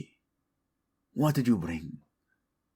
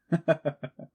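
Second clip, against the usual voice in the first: a brief falling chuckle; short amused laugh; 9 semitones higher than usual. Acted. King teasing his chancellor, short amused chuckle.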